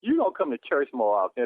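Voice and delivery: ordinary talk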